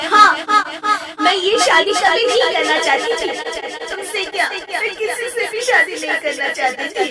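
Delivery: high, loud, anguished cry; anguished outburst. Acted. Wife crying out that she never wanted the marriage. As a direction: blurt it out in pain.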